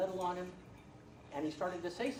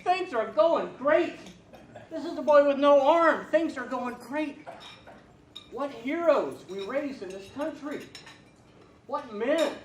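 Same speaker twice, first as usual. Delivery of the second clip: rising, louder and slower with each line; from steady to loud, slow and overwhelmed; swelling emotion; 6 semitones higher, 8 dB louder, slower than usual. Acted. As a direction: let the feeling build as you dictate. Dictating letter, emotion swelling.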